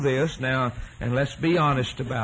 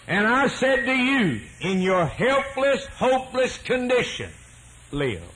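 higher, emphatic preaching cadence; 4 semitones higher than usual. Acted. Preacher driving his teaching home to the congregation, emphatic. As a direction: drive each point home.

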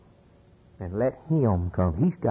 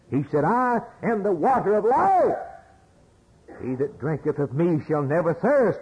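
full-force preaching; 8 semitones higher than usual. Acted. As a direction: build the list to a shout.

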